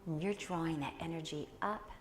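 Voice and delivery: soft, hushed meditation guide, half whispered; guided meditation. Acted. Teacher guiding the class into meditation, soft hushed voice.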